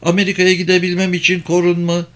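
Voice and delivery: high-pitched insistent demand; girl crying out. A narrator's reading. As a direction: demand it as the protesting young woman. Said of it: "diye bağırdı kız".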